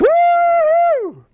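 slow, high, stretched woo-hoo; high joyful woo-hoo after a shush; drawn-out whoop. Acted. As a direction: let the whoop ring out long. Healed man keeps whooping.

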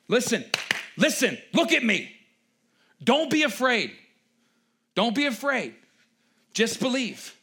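loud, high, urgent reassurance; Jesus urging the grieving father, loud and pressing; urgent plea. Retold or imitated. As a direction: grab his attention and plead, loud. Jesus urging Jairus in retelling.

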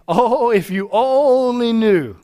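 knowing lament. Acted. God to people who push him away, knowing lament. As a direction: stretch it out with knowing regret. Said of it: "not laughing at you in a vindictive sense".

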